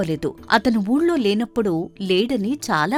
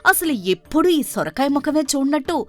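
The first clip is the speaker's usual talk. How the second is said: higher, sharp scolding rant at her husband; angry outburst; 5 semitones higher than usual. Acted. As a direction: lash out at him for waking her. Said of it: "కోపం వచ్చి భర్తపై మరింత విరుచుకుపడుతూ". (నిద్ర చెడగొట్టిన భర్తపై కోపంగా విరుచుకుపడుతూ)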